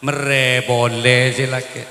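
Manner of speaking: loud laughing aside. Real, not acted. Preacher cracking up at his own joke, loud laughter. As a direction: break up with laughter mid-line.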